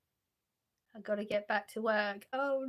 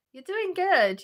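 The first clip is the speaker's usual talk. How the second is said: higher and louder, cheering on; bright encouragement; 8 semitones higher, 7 dB louder, slower than usual. Real, not acted. Reading a kind chat message aloud, higher and louder encouragement. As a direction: lift it up like a pep talk.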